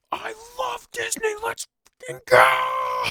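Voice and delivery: yelled as the ride takes off; excited shout. Retold or imitated. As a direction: scream it like a fan at launch. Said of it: "I went".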